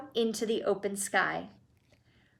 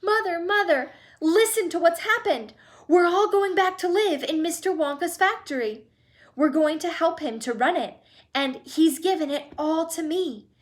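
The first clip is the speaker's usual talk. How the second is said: higher, tumbling with joy; excited; 7 semitones higher, 4 dB louder than usual. A narrator's reading. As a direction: spill the news out breathlessly. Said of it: "Charlie bursting with news".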